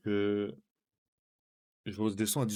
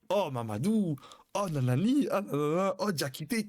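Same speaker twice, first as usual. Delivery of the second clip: calling out names in a mock African accent, higher; hammed-up African accent calls, pitched up; 5 semitones higher than usual. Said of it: "il commence à dire plein de noms, euh, à la sonorité africaine". (noms lancés avec un faux accent africain)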